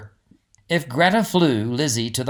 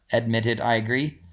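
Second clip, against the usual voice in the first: ordinary delivery, nothing marked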